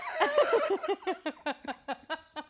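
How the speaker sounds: high, long run of laughter; real giggling fit; helpless laughter. Real, not acted. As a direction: burst out laughing and keep going. Cracking up at own story.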